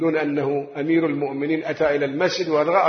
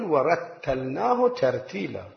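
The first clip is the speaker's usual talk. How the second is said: drawn-out Quranic recitation; slow recitation; 4 semitones lower, 4 dB quieter, slower than usual. Shown as a demonstration. Preacher showing the congregation unhurried recitation. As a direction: recite it slowly, word by word. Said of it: "الترتيل يعني القراءة المتأنية".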